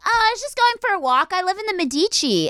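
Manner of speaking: put-on high, fast girlish chirp; breezy naive teen voice; innocent teen girl. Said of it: "And I was like".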